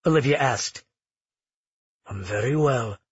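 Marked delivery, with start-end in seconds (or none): 2.1-3.0 a lightly voiced smooth, easy reply; smoothly. A narrator's reading. Magnus reassuring Olivia, smooth and easy. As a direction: say it lower, smooth and unbothered. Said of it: "Magnus said smoothly".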